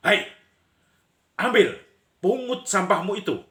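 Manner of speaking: sharp scolding shout, high and forceful; acted angry shout. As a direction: yell a high, loud order at a litterer. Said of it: "orang lain langsung teriak".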